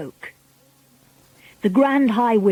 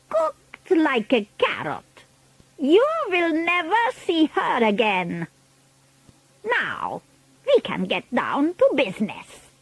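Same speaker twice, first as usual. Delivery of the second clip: high witch voice, dropping for business; Grand High Witch; 7 semitones higher, 3 dB quieter than usual. A narrator's reading. Witch leader gloats, then turns to business, imperious witch voice.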